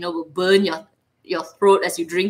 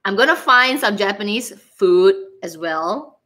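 normal talking voice

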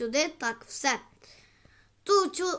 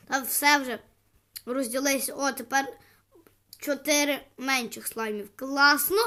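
usual speaking voice

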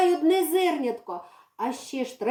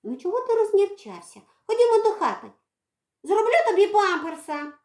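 high, sing-song cooing; doting mother; 7 semitones higher than usual. Acted. Girl coaxes the cat inside for a nappy. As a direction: coax him like a baby.

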